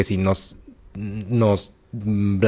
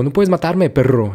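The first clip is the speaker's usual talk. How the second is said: higher mock yell; retold yell with insult; 6 semitones higher than usual. Woman shouts at soldiers, mild mock yell. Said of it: "lo grita".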